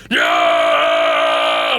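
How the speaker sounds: long low growling "no"; Hulk-like roar. Retold or imitated. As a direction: strain out a mock monster roar. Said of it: "Like he's the fucking Hulk".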